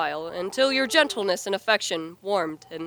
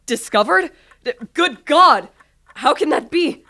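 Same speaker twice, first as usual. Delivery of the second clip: loud, rising shocked questions; shocked disbelief; 5 semitones higher, 8 dB louder than usual. Acted. Victor hearing the murderer was found, shocked. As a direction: gasp in disbelief at the news.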